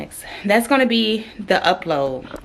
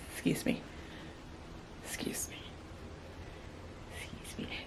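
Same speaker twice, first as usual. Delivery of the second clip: softer each time, whispered; fading whisper; 19 dB quieter, faster than usual. Real, not acted. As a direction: trail off into a whisper. Fading apology after swearing.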